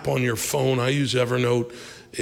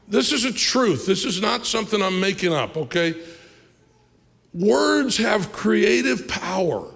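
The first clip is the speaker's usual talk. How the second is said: preaching at full force; 8 semitones higher than usual. Acted. Pastor driving home the point to the congregation, full-force preaching. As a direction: preach it out to the crowd.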